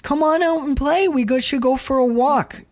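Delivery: put-on high, wheedling little-girl voice; childish whine. Wife begging her husband to come play, in a high childish whine. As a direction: plead like a kid wanting to play. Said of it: "weird childish note".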